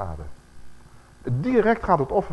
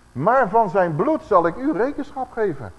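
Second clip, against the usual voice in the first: slow, loud, higher proclamation; preaching scripture; 6 semitones higher, 6 dB louder than usual. Acted. Preacher proclaiming God's word to the church, slow and loud. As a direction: declaim the verse like a prophet.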